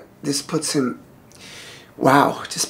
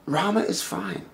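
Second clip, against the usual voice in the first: softer, steady reassuring tone; calm reassurance; 5 semitones higher, 5 dB quieter than usual. Acted. Brother-in-law calms the panicked wife, soft and reassuring. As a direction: settle her quietly, no alarm.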